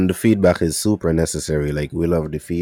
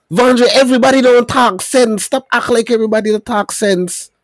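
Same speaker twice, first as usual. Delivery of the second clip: much higher and louder, playful telling-off; hammed-up protest at the co-host; mock scolding; 9 dB louder than usual. Host teasing co-host Vondre for waving, playful loud protest.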